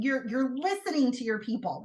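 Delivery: ordinary talk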